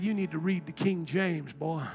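put-on low, soft, heavy southern drawl with a dragged boy; exaggerated stern drawl. Preacher mock-imitating the boss, low and drawn out. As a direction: ham up the old southern boss. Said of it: "He didn't say it like that".

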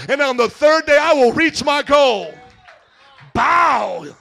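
loud, high, shouting sermon; preaching at full force. Acted. Pastor preaching to the congregation, shouting. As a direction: preach it hard, voice ringing to the back row.

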